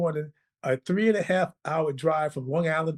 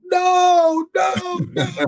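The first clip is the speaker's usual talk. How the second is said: high, loud disbelieving "no, no, no"; a friend's shocked, high-pitched protest; stunned shout; 14 semitones higher, 5 dB louder, much slower than usual. Retold or imitated. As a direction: shout it up high like you can't believe it. Fred's stunned reaction, retold.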